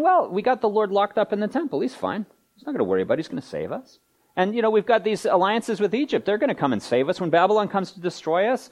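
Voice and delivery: mock breezy confidence, mostly pitched high; complacent Israel. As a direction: lightly play a people with no worries. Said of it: "And then Israel's saying".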